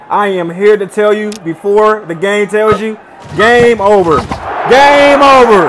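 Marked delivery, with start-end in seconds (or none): 3.4-4.2 loud, raised victory call; proudly called out; triumphant call. Real, not acted. Calls the championship win. 4.7-5.6 much louder, high-pitched victory shout; roaring celebration; triumphant shout. Real, not acted. Calls the championship win louder.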